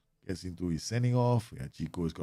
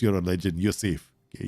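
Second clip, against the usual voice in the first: ordinary talk, nothing marked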